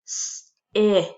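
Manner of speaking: hissed "S-" then loud "it"; segmented sounds. Shown as a demonstration. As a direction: split sit into its sounds. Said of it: "Eh is our middle sound".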